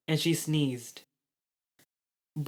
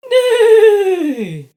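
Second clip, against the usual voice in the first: very high, loud horse neigh; 12 dB louder than usual. A narrator's reading. Dragon Rascal neighing as Robbie rides her. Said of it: "Rascal neighed".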